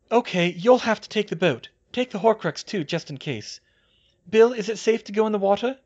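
high, loud, urgent instructions; brisk directions ending in a quick question; Hermione's voice. A narrator's reading. Hermione takes charge, voiced by narrator.

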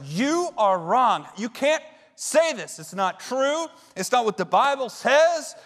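slow, high and loud, hammering each word; heated accusation. Retold or imitated. Student stands and loudly corrects his famous professor. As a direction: lecture the professor with righteous heat. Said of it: "this is exactly, I go".

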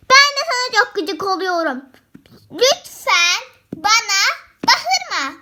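loud angry retort; shouting back. Pretend. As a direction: yell right back at her. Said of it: "bana bağırma diyorsun".

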